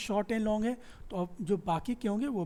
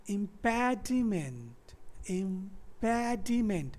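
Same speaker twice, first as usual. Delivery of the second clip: impediment very slow, every syllable stretched out; much slower than usual. Shown as a demonstration. Teacher showing how many syllables it has, very slow breakdown. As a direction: draw impediment out into im-pe-di-ment. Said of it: "इसमें कितने syllable हो गए".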